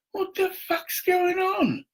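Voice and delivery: high, slow, startled yelp; shocked outburst. Retold or imitated. Back from Tesco, finds police outside, shocked high yelp. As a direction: blurt it out in disbelief, way up high.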